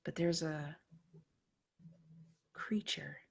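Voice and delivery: dark dream narration; hushed horror narration. A narrator's reading. GM narrating a creepy dream scene.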